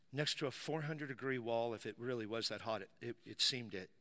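low, soft and halting; grave, hushed storytelling. Retold or imitated. Preacher recalling claustrophobia in the sauna, hushed and grave. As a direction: drop to a quiet, weighty confession with long pauses.

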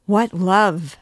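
put-on the girl shudders back at the priest's confession; shuddering question. A narrator's reading. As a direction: recoil in dread.

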